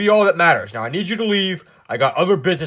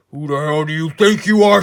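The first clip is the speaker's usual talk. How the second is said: ordinary talk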